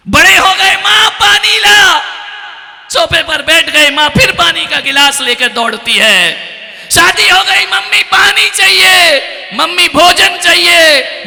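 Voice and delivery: very high, loud, ringing cries; full-force preaching. Acted. Grown son keeps calling mother for water, shouted by the preacher.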